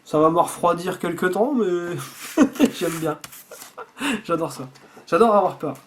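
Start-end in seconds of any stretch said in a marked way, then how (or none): 2.0-2.7 short higher-pitched laugh; amused laugh. Real, not acted. Admitting the gore will chill them, amused laugh. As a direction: laugh at your own squeamishness.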